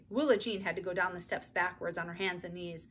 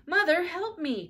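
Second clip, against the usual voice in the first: higher, slow plea; crying for help; 9 semitones higher, 6 dB louder, slower than usual. A narrator's reading. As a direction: call to mother for help. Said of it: "cried Beezus".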